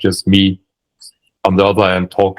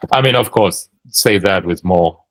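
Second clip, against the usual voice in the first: normal talking voice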